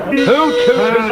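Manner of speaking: high falsetto who toot; squeaky mock owl call; silly high hoot.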